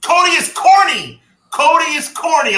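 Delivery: high, loud taunting chant; heckling shout. Pretend. Co-host butting in to make a slogan, playful heckling shout.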